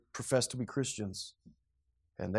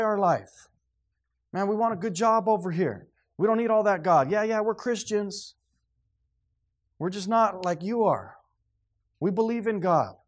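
a lightly voiced casual brush-off, higher; dismissive grandkids; 9 semitones higher, 6 dB louder than usual. Grandchildren brushing off their grandpa, lightly voiced and dismissive. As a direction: shrug off grandpa's faith.

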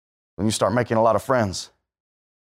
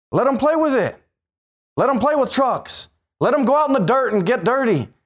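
high, forceful preaching; fiery preaching; 11 semitones higher than usual. Acted. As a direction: hammer it home from the pulpit.

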